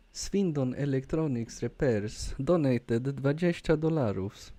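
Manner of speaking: Polish-accented donation read-out. Shown as a demonstration. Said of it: "say it in a Polish accent".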